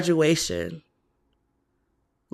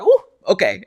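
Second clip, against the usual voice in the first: mock Ebony composure; 6 dB louder than usual. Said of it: "she's like".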